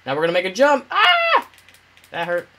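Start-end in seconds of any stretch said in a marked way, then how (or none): 0.9-1.5 sudden high yelp of pain. Real, not acted. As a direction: yelp as you land hard. Hurt in jump.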